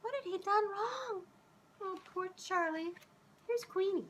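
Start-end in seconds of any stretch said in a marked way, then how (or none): none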